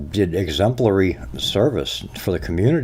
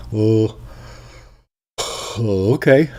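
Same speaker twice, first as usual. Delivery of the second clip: gullible public; 3 semitones higher, much slower than usual. Retold or imitated. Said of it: "They just sat there and went".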